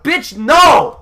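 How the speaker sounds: loud, high outraged no; indignant shout. Retold or imitated. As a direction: yell it at the dog. Scolding the dog in retelling.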